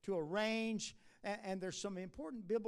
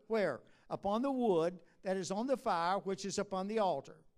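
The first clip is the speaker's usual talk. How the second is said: scripture reading with force; 7 dB louder than usual. Acted. Preacher reading Leviticus to the congregation, weighty and loud. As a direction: proclaim each phrase of the text.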